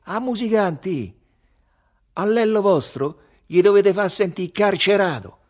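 high, loud demand; calling out. A narrator's reading. Lello calls to the musicians for his song, calling out. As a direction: holler a request at the band.

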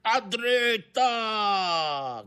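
high, very long-drawn order; shouted command. A narrator's reading. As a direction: stretch the steering order over the storm. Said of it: "gridò padron Ntoni". (comando gridato, acuto e lunghissimo)

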